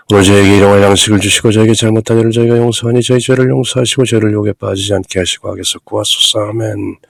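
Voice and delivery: Korean Lord's Prayer gabbled very fast with no pauses; rushed fast. Shown as a demonstration. Priest mocking how the congregation rushes the prayer, very fast. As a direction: race through it in one breath. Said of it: "그저 빠르게".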